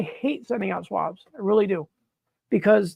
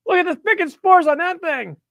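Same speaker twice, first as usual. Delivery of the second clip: much higher and louder; exclaiming; 9 semitones higher, 5 dB louder than usual. Real, not acted. Showing the Sharpie-faked swab, loud exclaim. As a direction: burst out in mock amazement.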